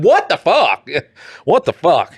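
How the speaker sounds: high disbelieving cry of what the fuck; shocked outburst, laughing through the repeat; incredulous. Retold or imitated. Listener to a rich man's story, high shocked disbelief. Reacting to absurd budget.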